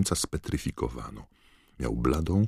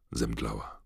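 plain reading voice

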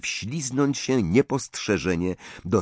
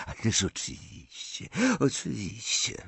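softer, half-voiced mumble; muttered; 4 semitones higher, 6 dB quieter than usual. A narrator's reading. Bordzin bowing to Malfoy, muttering softly. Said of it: "mruknął Bordzin".

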